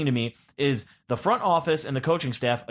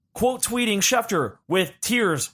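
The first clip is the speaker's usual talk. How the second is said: ordinary talk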